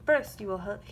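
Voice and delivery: plain reading voice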